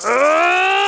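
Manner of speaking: high, disgusted "Ugh!"; mock disgusted groan. You seeing an annoying person walk in, high groan of disgust. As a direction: groan at someone you can't stand. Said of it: "you're just like".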